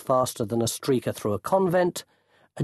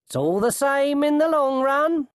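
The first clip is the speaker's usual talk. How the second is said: high, menacingly cheery taunt; taunting call; 14 semitones higher, 4 dB louder than usual. A narrator's reading. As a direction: call it out as if either outcome suits you. Same voice taunts dangling thief.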